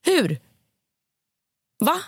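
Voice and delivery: exasperated. Real, not acted. Outraged rant.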